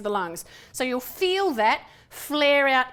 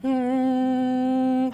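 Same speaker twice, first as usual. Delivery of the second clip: louder hum on a higher note; 5 dB louder than usual. Shown as a demonstration. Coach showing a higher note for the warm-up, ringing hum. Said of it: "higher notes warm your voice up better".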